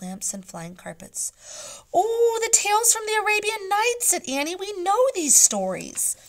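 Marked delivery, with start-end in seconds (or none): 1.9-6.2 much higher and louder, delighted; excited recognition. Acted. Annie thrilled to recognise the Arabian Nights tales. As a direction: burst out in delight.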